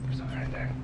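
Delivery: hushed urgent whisper. Real, not acted. Exploring an abandoned building, whispering to Chelsea to listen. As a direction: whisper so nothing hears you.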